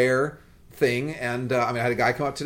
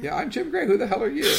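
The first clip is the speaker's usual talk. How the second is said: mock intro; 9 semitones higher than usual. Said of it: "mock podcast intro".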